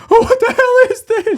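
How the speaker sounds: loud, very high mock-terrified cry; hammed-up panic, high and loud; acted horrified outburst. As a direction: shriek it like a scared kid at the cinema. Reliving childhood terror at film.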